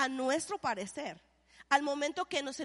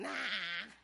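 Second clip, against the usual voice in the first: dismissive nah; 7 semitones lower, 9 dB quieter than usual. Retold or imitated. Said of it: "todos los mexicanos".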